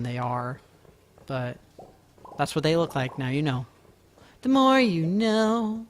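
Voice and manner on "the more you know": higher, slow, sung-out TV slogan voice; TV psa slogan. Retold or imitated. Streamer joking he's a news network, slow PSA slogan. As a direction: draw out "more" like a network PSA tag line. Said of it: "I'm basically N B C".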